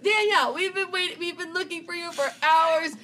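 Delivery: high, loud shouting through the door; frantic friends shouting. Retold or imitated. Friends banging on the guy's door, shouting her name. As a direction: bang and call out her name. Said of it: "then they're like".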